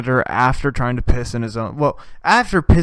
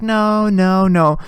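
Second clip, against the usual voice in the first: mock refusal; 6 semitones higher, 7 dB louder, much slower than usual. Cop refusing in retold skit.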